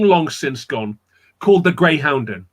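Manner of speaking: plain reading voice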